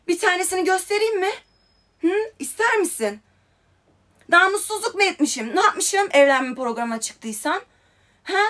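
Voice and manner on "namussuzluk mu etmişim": normal talking voice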